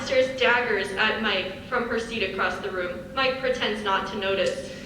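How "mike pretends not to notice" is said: usual speaking voice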